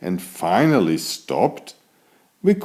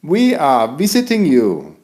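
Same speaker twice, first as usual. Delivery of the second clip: friendly call; 5 semitones higher than usual. Acted. Child calls to moon.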